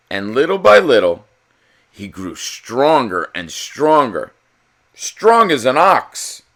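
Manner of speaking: animated storybook narrator; story narration. A narrator's reading. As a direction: read it like a bedtime picture book. Reads picture book aloud.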